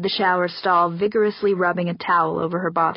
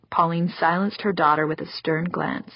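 ordinary delivery, nothing marked

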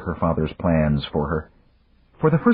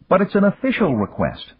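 "official" stressed, voice much higher; stressed word; 13 semitones higher than usual. A narrator's reading. Pete pressing Dan to accept, stress on "official". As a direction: lean hard on "official". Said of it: "Pete Weston stressed the word".

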